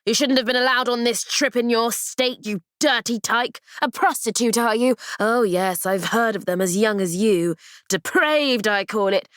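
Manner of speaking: contemptuous, sneering nurse; snarling nurse. Acted. Nurse scolds sick Amy, snarling. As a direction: spit the scorn at the girl. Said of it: "Her voice snarled".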